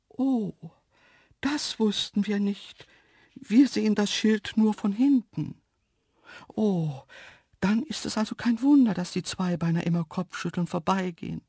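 high dainty voice, let down; disappointed antelope. A narrator's reading. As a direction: realise it with disappointment in a high creature voice. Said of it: "Die Stimme klang enttäuscht". (enttäuschte, hohe Antilopenstimme)

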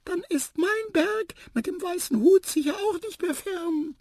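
tiny, very high mouse voice; little hopeful mouse. A narrator's reading. Mouse hoping her mountain is near, tiny high voice. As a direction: pipe it up high, full of wonder.

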